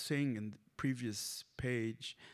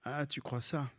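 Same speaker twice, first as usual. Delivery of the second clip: ordinary talk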